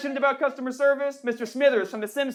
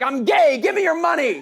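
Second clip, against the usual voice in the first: louder and higher, a hammed-up demand; mock demand shout; 4 semitones higher, 6 dB louder than usual. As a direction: shout it like a cheeky beggar. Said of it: "and be like".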